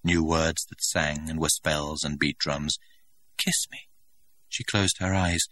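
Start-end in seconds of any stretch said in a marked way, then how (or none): 3.4-3.9 whisper. Acted. Lover whispers a plea.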